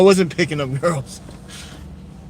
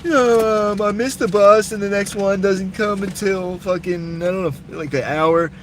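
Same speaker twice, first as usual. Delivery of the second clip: high, whiny imitation of a tearful woman; imitating a crying lady begging for a ride; mock sob story plea; 6 semitones higher than usual. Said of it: "sob story".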